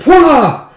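loud, high "pwah"; acted big relieved exhale; gasp for air. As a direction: burst out as if surfacing for breath. Brothers burst out from hiding.

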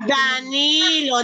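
stretched out slowly for the class to write; slow dictation. Shown as a demonstration. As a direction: spell it out slowly for kids. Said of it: "teacher dictates word slowly".

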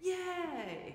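high, drawn-out mock yay; demonstrated cheer. Shown as a demonstration. Pastor teaches children to cheer things they like, playful cheer. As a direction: show them a gleeful cheer. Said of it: "I want you to go".

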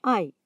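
ai as one gliding "eye" sound; ai as a single falling diphthong; German ei sound. Shown as a demonstration. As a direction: say ai like English "eye". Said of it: "La combinación ai se pronuncia".

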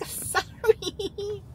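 put-on a timid girl apologising; meek little voice.

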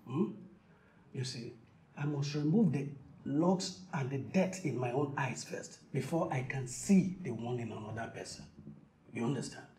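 quiet and gentle; soft reassuring. Acted. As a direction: soothe a scared child softly. Soothing child softly.

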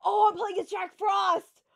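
loud delighted cry; thrilled yell of discovery; excited shout. Real, not acted. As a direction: shout it out in sudden joy. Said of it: "I love this now".

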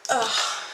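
loud grunt mid-stretch; stretching groan. Real, not acted. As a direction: groan as the muscles pull. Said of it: "Sorry, I'm just stretching".

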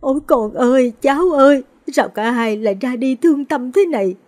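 high, loud keening lament over the coffins; wailing grief. A narrator's reading. Grandmother wailing over her son and grandson's coffins, keening. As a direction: cry out to heaven in raw grief. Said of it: "bà gào thét bên quan tài".

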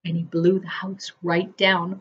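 ordinary delivery

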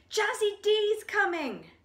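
high, loud warning shout; frightened cry. Acted. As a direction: sound the alarm in fear. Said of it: "they cried".

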